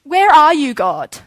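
loud, high, impatient call to God; impatient demand. Retold or imitated. Impatient believer calling out to God, loud demand.

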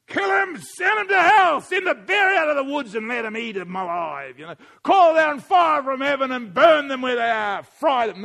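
preacher mimicking a furious call for vengeance; vengeful shout. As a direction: bellow curses at the enemies. Vengeful outburst set against Jesus.

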